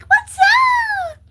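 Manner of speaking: high, loud mock hello; mock greeting. Annoying classmates greeting her, high squealed mock. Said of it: "they'll be like".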